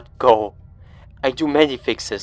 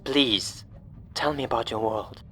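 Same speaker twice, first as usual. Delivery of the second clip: put-on heavy, German-accented plea; 9 dB quieter than usual. Radio stranger asks about their world, with heaviness. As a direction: plead quietly with a heavy heart. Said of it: "with heaviness in his tone".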